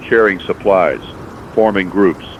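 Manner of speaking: usual speaking voice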